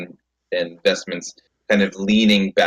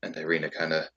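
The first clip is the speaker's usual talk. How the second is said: usual speaking voice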